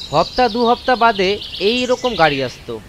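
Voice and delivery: plain reading voice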